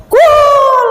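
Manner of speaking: loud, high drawn-out cheer; goal shout. Acted. Father ignoring his crying child for football, cheering a goal. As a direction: holler it like a football fan.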